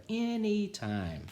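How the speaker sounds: knowing repeat. A narrator's reading. Barbara answers her sister, high slow sly repeat. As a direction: savour the secret.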